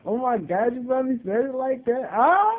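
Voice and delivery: giddy high shriek; excited squeal. Real, not acted. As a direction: squeal with delight at how she said it. Excited reaction to friend's greeting.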